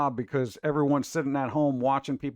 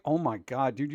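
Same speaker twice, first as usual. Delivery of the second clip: ordinary talk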